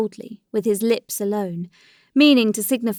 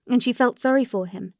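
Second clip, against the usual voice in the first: ordinary delivery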